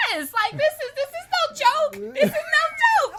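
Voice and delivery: very high, loud wailing retelling; upset protest. Retold or imitated. Retelling her protest to laughing friends, loudly upset. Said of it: "I was so upset".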